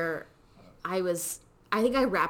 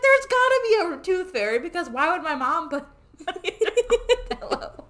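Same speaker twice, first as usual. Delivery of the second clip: higher, louder, laughing through the words; laughing kid logic; 10 semitones higher, 6 dB louder than usual. Retold or imitated. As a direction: giggle as a convinced child. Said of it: "So I was like".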